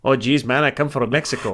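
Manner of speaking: shaky amateur Mexican accent. Shown as a demonstration. Co-host asks to hear it, amateur Mexican accent. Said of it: "Let's hear your Mexican".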